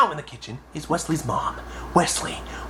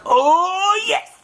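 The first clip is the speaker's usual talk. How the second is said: high-pitched thrilled mock glee; giddy excitement. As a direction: squeal with glee at the prank. Hiding in trash, excited.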